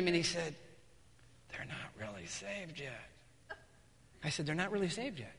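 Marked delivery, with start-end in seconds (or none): 1.5-3.6 very soft, slow confiding; retold low, hushed confession; hushed admission. Young man confesses privately to pastor. 4.5-5.3 soft echo at normal pace; quicker, quiet repeat of what was heard; questioning echo. Retold or imitated. Pastor repeats the confession back.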